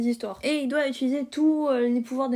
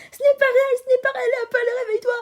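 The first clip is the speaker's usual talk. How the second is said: much higher and louder, frantic; frantic plea; 12 semitones higher, 9 dB louder, much faster than usual. Acted. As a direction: shout at her to wake up, panicking. Girls shout to wake Apple.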